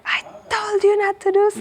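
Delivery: high-pitched imitation of a scolding friend; Pinar's scolding. Friend scolds her after hearing story.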